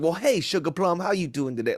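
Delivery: put-on thick Southern accent, affectionate greeting; super Southern. Grandchild imitating New Orleans grandma, super Southern. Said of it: "Like, super Southern".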